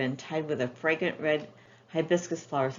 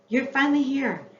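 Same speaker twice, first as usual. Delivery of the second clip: excited greeting; 9 semitones higher, 4 dB louder than usual. Acted. Friend arrives, Kukana greets happily.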